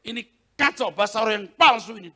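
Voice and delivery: loud, fast command to be silent; angry rebuke. Retold or imitated. Preacher rebuking a spirit, angry and loud. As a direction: shout down the false tongues. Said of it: "Saya bilang".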